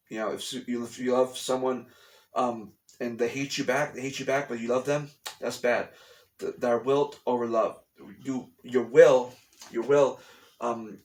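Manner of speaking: ordinary talk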